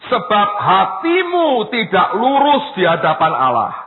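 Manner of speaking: loud, forceful Bible reading; scripture reading. A narrator's reading. Preacher reads Peter's rebuke to congregation, loud scripture reading. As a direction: proclaim the passage with weight.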